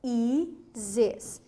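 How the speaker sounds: "Raízes" split into separate syllables with pauses. Shown as a demonstration. Teacher shows students the hiatus, "Raízes" syllable by syllable. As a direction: break "Raízes" apart at each syllable. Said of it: "Segunda vogal do hiato".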